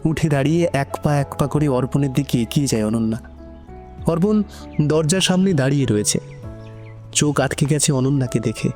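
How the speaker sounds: plain reading voice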